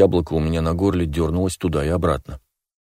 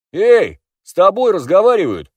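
loud high yell; 12 semitones higher, 6 dB louder than usual. A narrator's reading. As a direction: yell it out angrily. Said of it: "заорал Поли".

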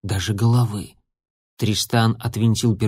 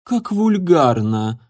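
slow, higher, sniffy disdain; disdainful; 4 semitones higher, slower than usual. A narrator's reading. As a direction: wrinkle your nose at it. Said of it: "наморщила нос Саманта".

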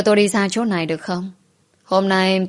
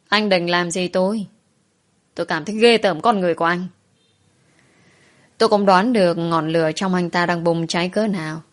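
usual speaking voice

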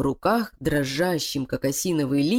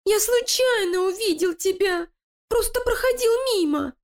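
whining rat. Acted. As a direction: plead like a frightened little rat. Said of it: "захныкал крыса". (хнычущая крыса)

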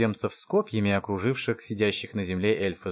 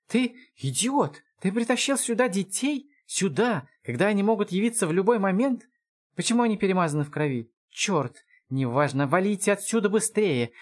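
high-pitched scolding of a horrified father; frantic outrage; 9 semitones higher than usual. Acted. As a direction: berate him in a panic. Разъярённый отец ругает за детей. (возмущённо, в панике, высоко)

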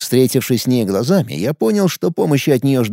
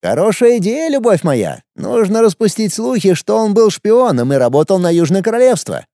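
put-on higher, boyish young emperor; teenage boy voice; 7 semitones higher than usual. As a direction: play an arrogant teenage ruler. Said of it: "сказал император". (голос подростка-императора)

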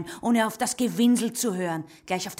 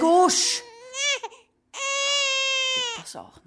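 high, tearful snap; sobbing woman silencing the girl; crying out; 3 dB louder, slower than usual. Acted. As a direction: shout through the tears. Crying, snapping back.

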